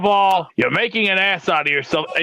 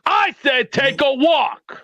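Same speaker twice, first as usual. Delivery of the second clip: raised, high-pitched, slow command; angry repeated order; 6 semitones higher, much slower than usual. Real, not acted. Host repeating himself to the caller, angry insistent order. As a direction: hit I SAID, then stretch out "take a walk".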